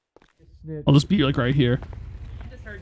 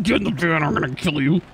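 mock menacing kidnapper; 8 semitones lower than usual. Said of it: "He said".

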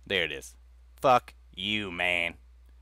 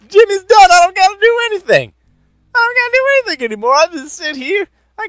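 ecstatic drug high; 9 semitones higher, 9 dB louder than usual. Acted. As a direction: squeal with overjoyed disbelief, almost crying. Ecstatic over finding the drugs.

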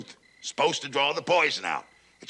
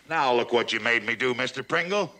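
normal talking voice

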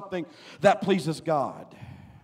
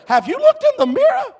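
very high, loud exclamation; preacher's shout; 10 dB louder than usual. Real, not acted. Preacher challenges those eyeing somebody else, shouted outburst. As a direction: fire the question out at a high-pitched shout.

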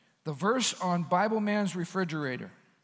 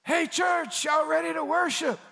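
high, loud, upbeat call to the crowd; rally the congregation like a worship leader; hype worship leader call; 9 semitones higher, 4 dB louder than usual. Shown as a demonstration. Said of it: "Talk to them".

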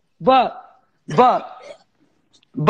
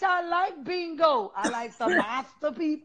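ordinary talk, nothing marked

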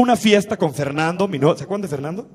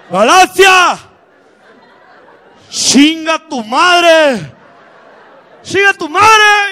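high, drawn-out full-force yell; shouting; 9 semitones higher, 8 dB louder, much slower than usual. Acted. Ezequiel yelling abuse at Galaxia over the music, high loud shout. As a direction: bellow the insult, stretching it out high. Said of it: "y empieza a gritar".

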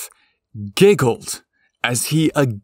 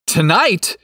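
objecting surprise; 7 semitones higher, 4 dB louder than usual. A narrator's reading. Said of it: "couldn't help himself but to object".

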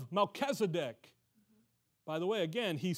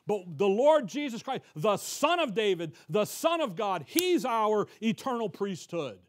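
louder, rising pulpit preaching; fervent preaching; 3 semitones higher, 5 dB louder than usual. Acted. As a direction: preach it out to the congregation. Preaching at full force.

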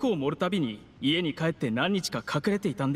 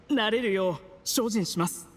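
coughing. Acted. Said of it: "これほど強いお酒は初めてで".

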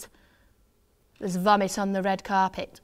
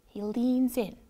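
plain reading voice

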